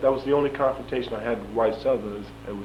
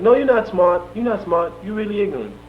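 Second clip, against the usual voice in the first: high mock-sheriff retort, loud at first; imitating Sheriff Tyson; 10 semitones higher, 6 dB louder than usual. Sheriff puts down the smart prisoner, high-pitched imitation. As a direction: contradict him in a raised, high sheriff voice. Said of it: "He said".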